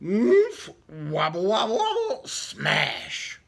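ordinary delivery

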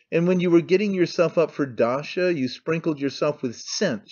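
plain reading voice

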